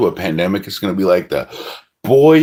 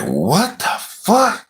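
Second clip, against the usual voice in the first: disbelief; 9 semitones higher, much slower than usual. Retold or imitated. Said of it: "I'm like".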